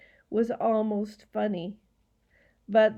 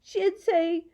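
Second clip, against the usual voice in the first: high, loud prim scolding; 8 semitones higher, 6 dB louder than usual. A narrator's reading. Girl quoting her mummy to the gaboon, scolding. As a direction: scold like an indignant little girl. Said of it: "Lindy scolded".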